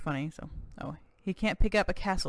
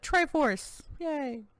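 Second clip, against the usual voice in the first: mock cheer; 9 semitones higher, much slower than usual. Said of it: "they just said".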